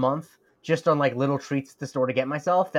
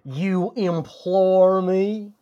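quoting a game character; 6 semitones higher, 4 dB louder, much slower than usual. Retold or imitated. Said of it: "That's the line I always remember".

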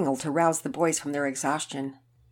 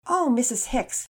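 earnest plea; 5 semitones higher than usual. Acted. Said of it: "she spoke up".

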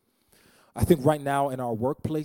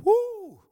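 joyful whoop; 3 dB louder than usual. Real, not acted. Preacher's joyful exclamation.

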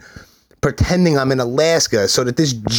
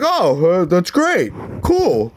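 oblivious stepfather impression; 4 semitones higher, slower than usual. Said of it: "Ronnie Cigars is half oblivious".

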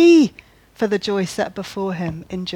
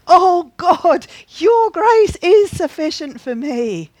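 much higher, louder joyful cry; loud ecstatic thanks to God; burst of joy; 8 semitones higher, 8 dB louder than usual. Pretend. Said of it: "receive that with a burst of joy".

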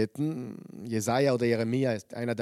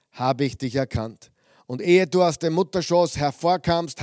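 louder, measured Bible reading to the congregation; solemn scripture reading from the pulpit; 5 dB louder than usual. Acted. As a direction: read the verses out like a proclamation. Reads Jeremiah chapter one aloud.

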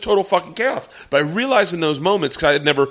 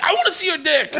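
mock heckler girl; 13 semitones higher than usual.

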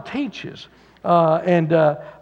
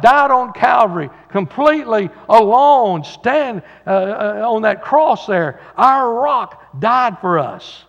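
louder, rising preaching, slowing at the end; preaching climax; 3 semitones higher, 5 dB louder, slower than usual. Acted. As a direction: build to the cross, then slow down. Climax of sermon on the cross.

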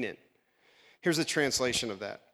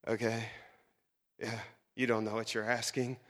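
exasperated; 5 semitones lower, 7 dB quieter than usual. Retold or imitated.

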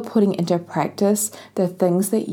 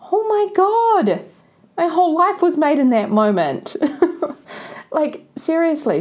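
much higher, gushing with joy; overjoyed; 8 semitones higher than usual. Real, not acted. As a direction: let the delight burst out. Moved by listener's thank-you message.

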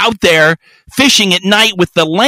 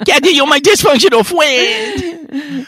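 put-on baby talk; 12 semitones higher than usual. Recalling sweet-talking his friend Kathy, in high baby talk. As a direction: coo it like talking to a toddler. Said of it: "baby talk on me".

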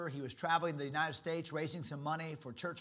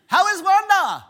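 very high, loud, bursting with excitement; excitable Brother Vic; 19 dB louder than usual. Retold or imitated. Imitating Brother Vic on the phone, high and loud. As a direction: boom it out like the most excitable guy alive. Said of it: "He said".

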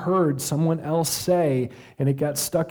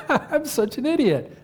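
high-pitched, laughing put-on lightness; mock cheerful self-insult; 11 semitones higher than usual. Shown as a demonstration. Preacher showing how he never sounds when angry, laughingly light. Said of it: "I never say when I'm really angry, like".

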